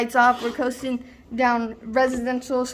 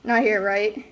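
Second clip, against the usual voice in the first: ordinary talk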